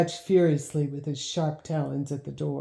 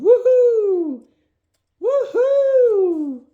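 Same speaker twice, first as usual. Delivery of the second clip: very high, loud cheering whoop; victory whoop; 9 dB louder, much slower than usual. Acted. Boy cheering his win, very high loud whoop. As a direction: whoop like you just won the race. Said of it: "threw up his arms ... and yelled".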